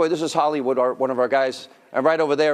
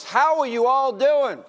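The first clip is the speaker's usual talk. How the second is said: raised pitch, projected rally call; pitched-up host working the audience; rallying the crowd; 6 semitones higher than usual. Real, not acted. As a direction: fire up a live crowd with questions. Host rallies cheering crowd with questions.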